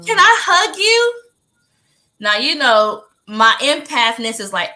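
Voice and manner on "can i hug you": imitated tearful plea. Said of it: "she is crying, crying, crying".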